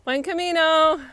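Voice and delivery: called out high and loud; called out greeting. Real, not acted. Calling out to a distant pilgrim, a loud high greeting. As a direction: call it out to a far pilgrim.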